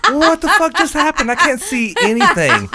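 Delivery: high, loud mock-panicked outburst; freaking out. Husband panicking as his screen goes tiny, freaking out. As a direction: act out panicking at the screen, voice shooting up. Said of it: "I'm kinda freaking out".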